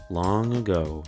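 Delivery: usual speaking voice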